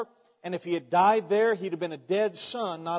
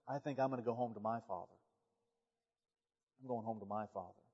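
low, very soft and quick; quiet homesick pig; 8 semitones lower, 13 dB quieter, faster than usual. Acted. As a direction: voice the pig's hushed, subdued decision. Pig quietly decides to leave for home.

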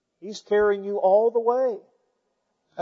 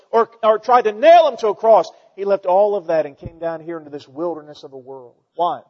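usual speaking voice

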